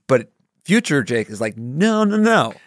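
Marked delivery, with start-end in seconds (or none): 1.6-2.5 high-pitched cartoonish no-no-no; mock objection. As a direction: object as your worried future self, pitched way up. Future self objects, acted quote.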